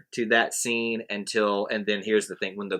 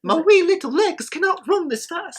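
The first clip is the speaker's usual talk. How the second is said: high, put-on Scottish old man voice. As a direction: squeak it like a wee old Scot. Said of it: "I'm doing it in the old man voice".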